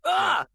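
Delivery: high sudden yell; short high-pitched yelp; startled film-hero cry; Michael J. Fox. Acted. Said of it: "you hear them yell or laugh or scream".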